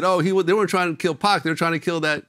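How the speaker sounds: loud, high comic rant, laughing partway; Guy Torry stand-up bit. Retold or imitated. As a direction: punch it like a comedian working the crowd. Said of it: "Guy Torry on stage was like".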